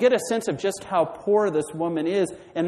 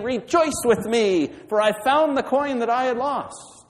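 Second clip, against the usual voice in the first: joyful calling out; 9 semitones higher, 4 dB louder than usual. Acted. Preacher as woman calling friends and neighbors, joyful call. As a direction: call it out in acted delight.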